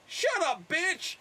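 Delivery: a hint of Jackson attitude; mock Samuel L. Jackson. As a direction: brush the attendant off as Jackson. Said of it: "Samuel L. Jackson".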